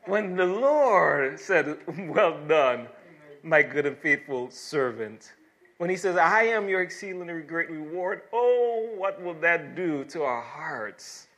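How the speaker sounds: loud, exultant pulpit proclamation; fervent preaching. Real, not acted. Preacher to congregation on hearing God's reward, fervent proclamation. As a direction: ring out God's promise with rising fervour.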